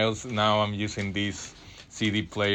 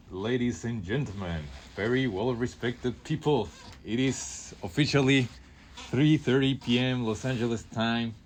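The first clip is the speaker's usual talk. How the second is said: grand, slowed-down show intro; a light hint of a formal announcer; radio host announcement; 3 semitones higher than usual. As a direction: welcome listeners like a classy radio host.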